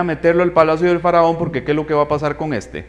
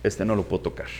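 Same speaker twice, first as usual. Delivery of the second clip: pharaoh backing off; 11 semitones lower, 12 dB quieter, faster than usual. Retold or imitated.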